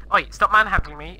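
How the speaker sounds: ordinary talk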